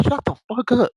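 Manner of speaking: mock irritated snap. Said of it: "she be like".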